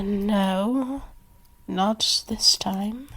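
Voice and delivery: usual speaking voice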